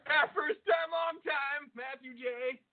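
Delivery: put-on call-in fan voice; classic radio caller. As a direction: play an eager longtime listener phoning in. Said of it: "We'll do one of those classic".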